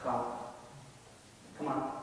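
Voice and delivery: Jesus calling softly, tender and low-key; gentle invitation. Pretend. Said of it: "You know what he's gonna say?".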